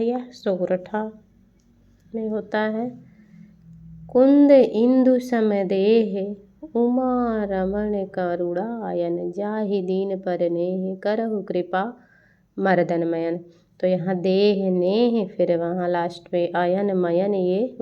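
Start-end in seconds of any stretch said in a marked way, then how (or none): 4.1-13.4 very slow, stretched chant-like recitation; verse recitation. Acted. Teacher reciting a sortha as example, very slow declamation. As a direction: declaim slowly from a loud opening, drawing out syllables.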